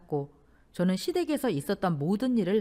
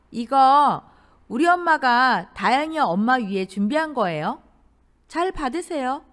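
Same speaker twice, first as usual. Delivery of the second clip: loud, higher sister-in-law voice; pushy sister-in-law; 4 semitones higher, 6 dB louder than usual. A narrator's reading. Sister-in-law handing over a shopping bag, self-important. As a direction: present it loudly, with self-importance.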